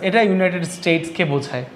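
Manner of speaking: ordinary talk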